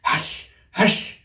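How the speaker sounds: whispered urgent hush; low hurried hush. A narrator's reading. Rabbit silences Alice, whispered hush. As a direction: shush her quickly. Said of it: "in a low, hurried tone".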